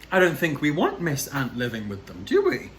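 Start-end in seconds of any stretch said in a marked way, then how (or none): 2.2-2.7 put-on high playful question to the kids; questioning children. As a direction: lift it up for the little listeners. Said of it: "asking the children".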